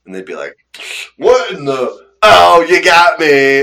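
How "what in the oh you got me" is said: high, loud, slowed groan of defeat; caught-out victim. Retold or imitated. Choir friend caught by the drink prank, high surprised groan. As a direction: realize you've been pranked.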